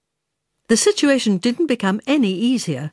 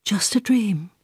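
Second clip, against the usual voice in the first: whisper. Acted. Half-dreaming voice in the dark.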